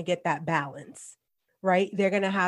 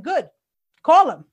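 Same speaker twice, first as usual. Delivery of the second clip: higher, louder mock dare; defiant retort; 8 semitones higher, 9 dB louder than usual. Mom daring her son to call child services, loud and defiant. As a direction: fire back daring him to call. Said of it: "I'm like".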